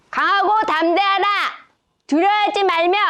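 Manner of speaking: clipped bursts, one chunk at a time; chopped and punchy. Shown as a demonstration. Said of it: "손을 번쩍 들면서 끊으면서 읽을 거예요".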